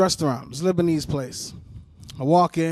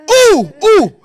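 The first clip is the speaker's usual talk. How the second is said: loud, high gorilla-like whoop; hammed-up enraged "ooh" yell; mock yelled hoot; 13 semitones higher, 11 dB louder than usual. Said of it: "turn into a fucking gorilla".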